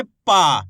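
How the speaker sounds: पा pushed out loud with a long aa; loud, drawn-out "aa" in पा; stressed long vowel. Shown as a demonstration. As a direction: hit पा hard and hold the aa. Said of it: "पा का उच्चारण कैसे करेंगे".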